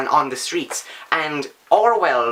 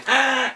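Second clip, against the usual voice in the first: mock shocked gasp; 9 semitones higher than usual. Shocked reaction to the book.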